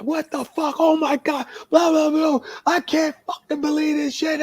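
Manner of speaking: high-pitched cussing rant; Dennis Carluzzo. Retold or imitated. Promoter storming into the locker room, cussing angrily. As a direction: rant in a strained, way-up imitation of a furious boss. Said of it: "He's kicking shit and he's cussing".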